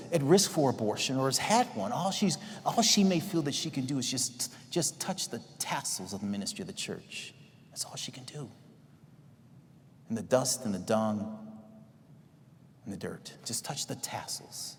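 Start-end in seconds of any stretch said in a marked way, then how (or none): 4.7-7.3 dropping to a hush. Acted. Sermon hushes to intimate image. 7.7-8.5 breathy whisper, barely voiced. Preacher whispers to the congregation about the woman's plight. 10.1-14.7 slow, soft, almost whispered; slow hush. Acted. Preacher slowly hushes the congregation on dust and dirt.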